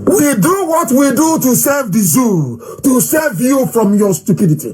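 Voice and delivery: high, drawn-out proclamation; impassioned oratory. Acted. Leader tells critics why the movement acts, declaiming. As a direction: proclaim your cause to a crowd.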